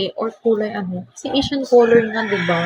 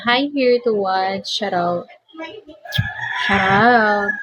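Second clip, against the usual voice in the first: ordinary talk, nothing marked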